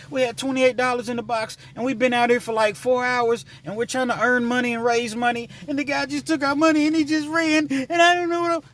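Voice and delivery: put-on high, rising child voice near tears; imitating distraught girls. Girl telling the driver the money was stolen, high and climbing to panic. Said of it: "They were like".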